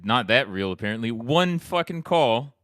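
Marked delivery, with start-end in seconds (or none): 1.1-2.5 slow, high mocking echo; mocking disbelief. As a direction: drag it out in disbelief. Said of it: "and all that for one fucking call?".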